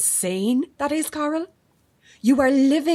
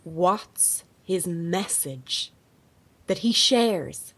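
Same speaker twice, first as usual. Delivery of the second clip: slow, pointed repeat; slower than usual. Acted. Reporter repeating her question to Carl, slow and pointed. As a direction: spell the question out slowly.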